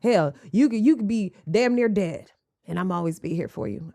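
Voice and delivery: normal talking voice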